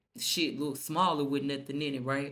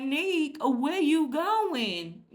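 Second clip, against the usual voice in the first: high, drawn-out imitation of a clingy girlfriend; whiny girlfriend imitation; 10 semitones higher, 4 dB louder, much slower than usual. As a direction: stretch "where you going" way up high, pleading. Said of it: "She like".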